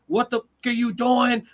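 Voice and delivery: mock coach screaming at a kid on the field; coach cussing out. Youth football coach yelling at his player, angry mock yell. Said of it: "coaches still talk to you crazy as hell".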